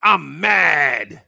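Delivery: loud, hammed-up angry shout; mocking bellow of outrage; mock mad. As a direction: imitate people bellowing how mad they are. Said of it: "People like".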